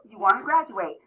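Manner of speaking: high, loud hype; excited cheer. Acted. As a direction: pump up the room. Cheering the graduation goal.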